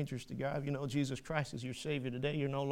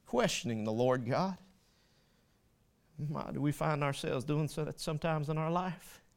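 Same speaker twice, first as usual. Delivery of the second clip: normal talking voice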